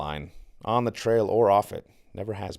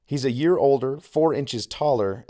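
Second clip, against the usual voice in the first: ordinary delivery, nothing marked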